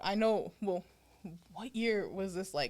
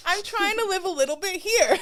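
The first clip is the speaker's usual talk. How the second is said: real laugh that breaks into high, louder words; 10 semitones higher, 8 dB louder than usual. Real, not acted. Admitting to her co-host she just wants to live, laughing. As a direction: laugh through the line.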